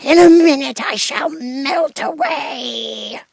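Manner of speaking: high, slow, crying voice; a witch crying out in despair; wailing witch. A narrator's reading. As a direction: wail in panic as you melt. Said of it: "she screamed".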